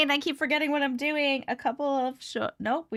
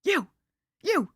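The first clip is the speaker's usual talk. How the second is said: higher, yappy little-dog voice; dog's voice; 7 semitones higher, slower than usual. Retold or imitated. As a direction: bark it out like a small dog. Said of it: "he's going".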